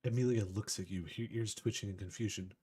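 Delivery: ordinary talk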